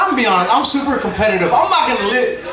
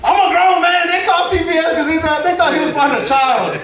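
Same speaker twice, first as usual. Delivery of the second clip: laughing excitement; 7 semitones higher than usual. Real, not acted. Comic laughing as he reveals he's a grown man. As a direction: build it up with a laugh.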